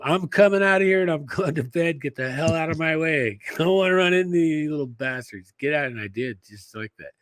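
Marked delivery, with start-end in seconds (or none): 0.0-5.9 bold warning. Retold or imitated. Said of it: "and told him".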